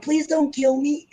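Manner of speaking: pleading dog voice. Retold or imitated. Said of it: "looking at me like".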